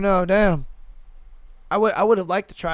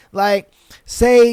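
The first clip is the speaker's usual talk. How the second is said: ordinary delivery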